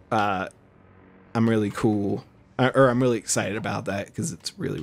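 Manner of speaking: ordinary delivery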